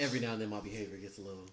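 deep, low Batman voice. Pretend. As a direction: keep it low like the caped hero. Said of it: "That was my Batman voice".

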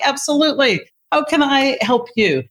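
high, sing-song eager welcome; loving helpfulness. Retold or imitated. Charge leader greets the upset nurse, loving and helpful. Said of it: "And I'm loving people up".

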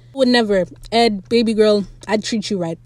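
ordinary talk

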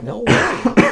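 high-pitched mock "no way"; quoted disbelief. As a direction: retell a reaction in a high voice. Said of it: "I was like".

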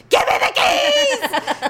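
slow, high, loud laughing yell; angry shout. Retold or imitated. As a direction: bark the demand for the keys. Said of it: "I was so angry".

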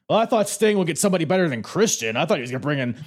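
high, brash wrestler promo; ranting like a pompous wrestler; Scott Steiner impression. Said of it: "Steiner's like".

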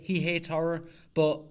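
normal talking voice

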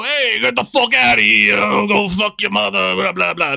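put-on Brooklyn accent. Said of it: "the Brooklyn accent is, like, this stereotypical".

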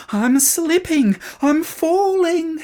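loud, high and slow panic; panicked yelling. A narrator's reading. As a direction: scream as you slide. Boy sliding down slope.

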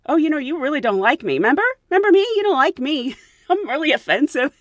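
a hint of sarcastic taunting; mock sarcastic jab. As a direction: needle him playfully. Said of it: "I'm not gonna say that".

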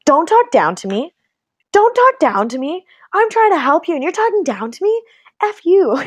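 rising, high-pitched retold telling-off; indignant comeback. Friend retelling her clash with a condescending husband, indignant. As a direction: escalate in affronted disbelief to a curt dismissal.

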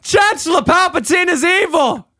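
hammed-up high shouting song; screaming Obi-Wan. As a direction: belt it out screaming like a frantic Jedi. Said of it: "you may do him screaming".

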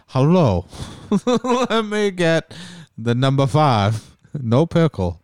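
put-on slow, heavy Eastern European accent; heavy foreign accent. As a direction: order slowly in a thick foreign accent. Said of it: "he has that heavy of an accent".

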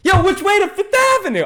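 high, loud street holler; yelling out. Retold or imitated. Blind man calls out for directions on the street, loud holler. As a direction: call out to the whole block. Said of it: "he yells out".

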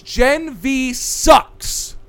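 drawn out, every word spelled out slowly; slow emphatic verdict. Acted. Cohost declaring the show bad, very slowly. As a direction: lay it down slowly like a final ruling.

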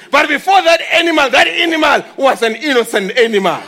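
ordinary talk, nothing marked

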